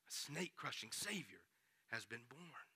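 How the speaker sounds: soft voiced repeat. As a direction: let it land gently. Preacher repeats, softly voiced.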